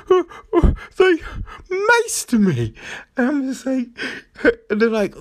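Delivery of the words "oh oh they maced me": high, whiny mock sob; imitating a woman whimpering after being maced; a hammed-up tearful wail; mock crying woman. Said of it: "And she's crying".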